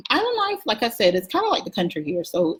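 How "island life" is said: "Island life" sung out high with a falling tune; excited sing-song. Shown as a demonstration. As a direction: lift "Island" way up, then let it fall.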